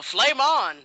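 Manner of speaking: high, loud battle cry; mock heroic yell. Host shows what the character should yell, loud cry. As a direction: yell it like a catchphrase. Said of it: "He's the one that should yell".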